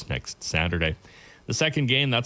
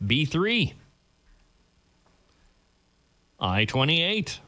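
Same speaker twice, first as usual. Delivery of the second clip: bingo caller; 4 semitones higher, much slower than usual. Real, not acted. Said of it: "I'm your bingo caller for this morning's games".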